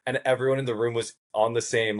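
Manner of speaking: usual speaking voice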